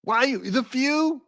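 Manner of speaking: high, put-on heckle; mock pundit. Host interrupting the clip, mocking high voice. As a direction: squawk it in caricature.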